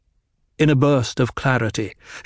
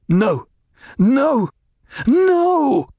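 building anguished shout; 13 semitones higher, much slower than usual. A narrator's reading. Paul in despair at his own mind, building shout. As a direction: break down, pounding the floor.

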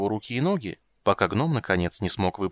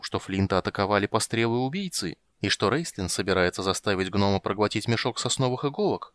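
plain reading voice

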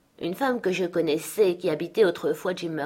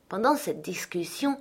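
ordinary delivery

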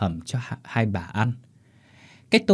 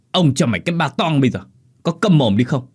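fast, raised scolding voice; angry threat; 4 semitones higher, 4 dB louder than usual. Acted. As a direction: cut the servant off angrily. Master threatens servant to shut up. (ông chủ quát dọa, nói nhanh)

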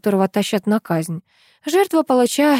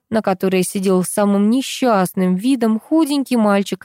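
ordinary delivery, nothing marked

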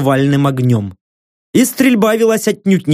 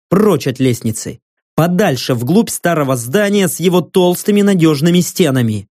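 ordinary delivery, nothing marked

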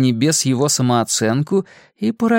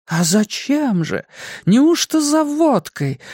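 high, slow fake fright; mock horror; 11 semitones higher, slower than usual. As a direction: gasp it in pretend horror. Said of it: "сделанным ужасом спросила она".

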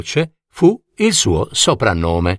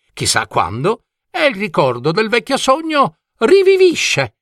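eccentric dragon coachman; 2 semitones higher than usual. Acted. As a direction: chat theatrically to a young passenger. Coachman's long speech to gull. (vecchio cocchiere bizzarro, chiacchierone e teatrale)

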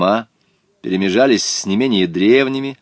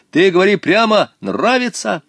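high, raised-voice demand; calling after someone; 10 semitones higher, 4 dB louder than usual. A narrator's reading. Boy demanding an answer from departing stranger, calling out. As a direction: press for a straight answer. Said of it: "крикнул он вдогонку".

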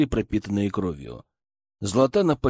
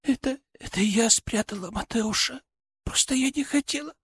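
put-on high weak girl voice; 11 semitones higher, 4 dB quieter than usual. Daughter voiced.

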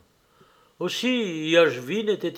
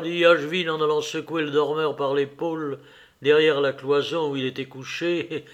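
ordinary delivery, nothing marked

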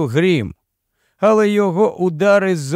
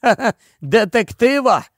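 high-pitched laughing disbelief; laughing scoff; 7 semitones higher than usual. A narrator's reading. Beaten Makar answers the gang's threat, laughing scornfully. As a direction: scoff through a short laugh. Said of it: "засміявся Макар".

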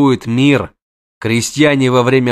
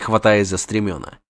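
normal talking voice

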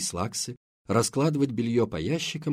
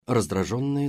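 usual speaking voice

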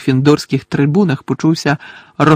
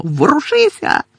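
very high urgent yell; Angelina's shout; 12 semitones higher than usual. A narrator's reading. Teammate flying past orders him to move, shouting. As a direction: snap him out of it with a high cry. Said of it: "закричала Анжеліна".

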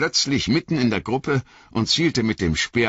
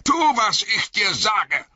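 much higher, sharp snapped order; wizard snapping. A narrator's reading. The wizard snaps at Aragorn, sharp and commanding. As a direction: bite it off at him. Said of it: "schnauzte der Zauberer ihn an".

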